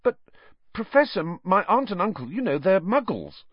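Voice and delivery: high, stammering appeal; pleading schoolboy. A narrator's reading. Harry appealing to McGonagall, high and pleading. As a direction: plead the case, tripping at the start.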